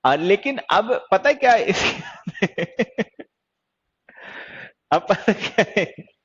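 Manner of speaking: slow, broken by real giggles; helpless laughter mid-explanation; laughing through words. Real, not acted. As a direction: try to go on but keep cracking up. Cannot stop laughing while explaining.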